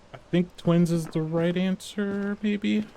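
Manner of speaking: unsure. Real, not acted. Unsure guess, drawn out.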